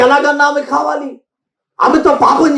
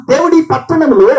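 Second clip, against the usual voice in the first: usual speaking voice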